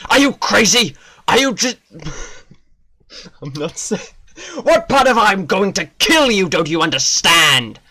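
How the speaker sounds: furious guardian. Acted. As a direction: yell at the madman. Guardian yells at the purifier.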